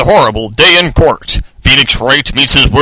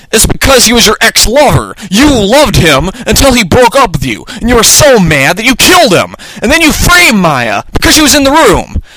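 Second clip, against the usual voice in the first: high lawyer's reveal, climbing higher; dramatic accusation; 8 semitones higher than usual. A narrator's reading. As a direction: build the accusation to a peak.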